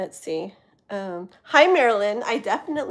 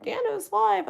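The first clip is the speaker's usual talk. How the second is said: high announcing voice; 13 semitones higher than usual. Retold or imitated. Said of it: "and it's like".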